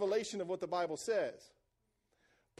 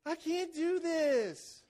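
whiny quitter; 5 semitones higher, much slower than usual. Retold or imitated. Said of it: "when somebody says".

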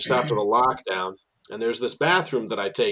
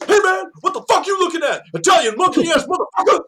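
much higher, loud furious yell of a hostile stranger; aggressive stranger impression; 7 dB louder than usual. A tour guide imitating a man yelling at him from the bathroom door. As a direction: bark it out like an angry man storming from a bathroom. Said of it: "This time I'm gonna do an accurate impression".

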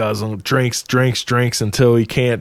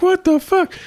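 mock bafflement. Retold Thor baffled by the challenge.

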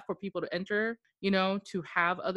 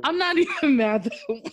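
higher, louder, laughing through the words; 8 semitones higher, 9 dB louder than usual. Real, not acted. Host excusing co-host who went to bed, laughing. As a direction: say it loudly through real laughter. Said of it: "I'm just laughing".

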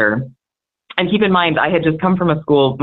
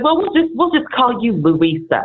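teacher voice; 7 semitones higher than usual. Retold or imitated. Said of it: "he said".

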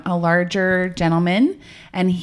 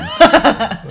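loud laugh; 6 semitones higher, 5 dB louder than usual. Real, not acted. Loud laugh after joke.

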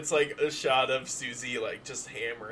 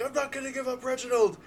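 high character voice; 13 semitones higher, faster than usual. Acted. Doctor refuses, high voice.

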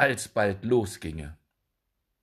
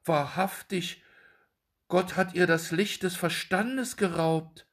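worried aside; 8 semitones higher than usual. A narrator's reading. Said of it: "sprach der Kleine bekümmert zu sich".

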